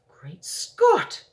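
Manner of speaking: a high, breathless Great Scott; shocked cry. Acted. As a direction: gasp it out in alarm. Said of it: "cried the famous voice".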